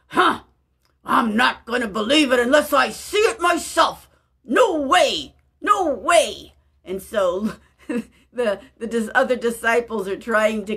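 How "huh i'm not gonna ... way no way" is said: loud, scoffing disbelief; doubting Thomas. Retold or imitated. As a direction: refuse to believe it, half-shouting. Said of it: "He's like".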